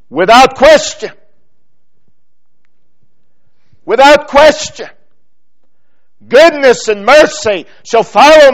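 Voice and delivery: high, loud pulpit proclamation; full-force preaching. Acted. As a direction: proclaim it with certainty to the congregation.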